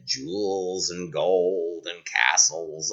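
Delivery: low, slow coaxing croak; tempting frog. Acted. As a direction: lure her slowly.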